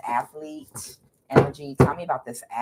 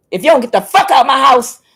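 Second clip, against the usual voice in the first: high, very loud outburst; angry shout; 11 semitones higher, 12 dB louder than usual. Retold or imitated. Woman ordering her ex out of her house, exasperated yell. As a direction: yell it to throw him out. Acted reply throwing the boyfriend out.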